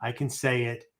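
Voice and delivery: normal talking voice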